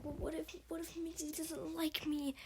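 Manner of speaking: high, anxious fretting; worried Finch. Retold or imitated. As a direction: fret about it in a small, high voice. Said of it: "she's like".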